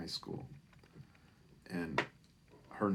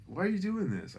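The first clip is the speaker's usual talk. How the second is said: much higher wondering ask; surprised question; 12 semitones higher, 5 dB louder than usual. Retold or imitated. Lisa asking Chris why cash, high surprise. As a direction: ask in touched disbelief.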